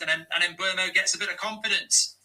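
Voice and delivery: usual speaking voice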